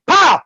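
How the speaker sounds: high, loud impact cry; shouted pow. Retold or imitated. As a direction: punch out the hit. Impact sound effect.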